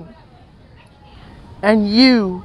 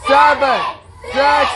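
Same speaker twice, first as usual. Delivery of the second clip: new year countdown crowd; 2 semitones higher, much slower than usual. Real, not acted. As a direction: shout the countdown to midnight.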